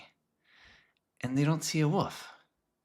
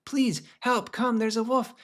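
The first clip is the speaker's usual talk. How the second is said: high panicked mock scream for help; boy faking a wolf attack to the village, high screaming; 10 semitones higher than usual. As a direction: yell it high like a faked alarm. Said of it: "he screams from the moment".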